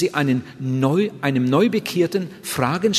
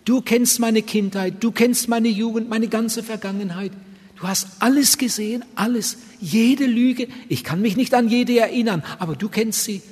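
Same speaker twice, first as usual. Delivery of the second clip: raised, heartfelt praying voice; lightly performed sample prayer, pitched higher; earnest prayer; 4 semitones higher than usual. Shown as a demonstration. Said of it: "Ich darf ihm sagen".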